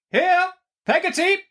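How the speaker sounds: high, commanding call. Acted. Betsy calling to the servant, commanding. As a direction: summon the servant down the passage. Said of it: "cried Miss Betsy".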